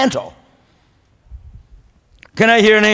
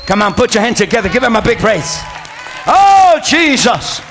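rousing praise shout; 4 semitones higher than usual. Real, not acted. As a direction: whip the congregation up into praise. Preacher rallies congregation to praise.

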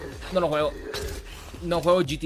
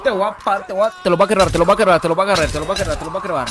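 excited yelling; 4 semitones higher, 7 dB louder, faster than usual. Real, not acted. Yelling excitedly during the fight.

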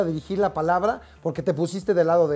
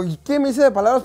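higher, louder indignant comeback; indignant retort; 8 semitones higher, 5 dB louder than usual. Retold or imitated. Challenging the policeman, indignant and raised. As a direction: throw the accusation back at him.

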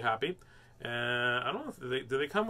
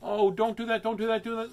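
startled; 8 semitones higher, 4 dB louder than usual. Real, not acted. Puppy peeing on her.

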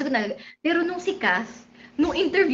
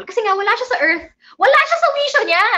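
fast, higher and louder, amused; excited; 8 semitones higher, 6 dB louder, faster than usual. Real, not acted. Vlogger laughing to fans about spaced-out Kath, excited. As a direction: blurt it out high and loud.

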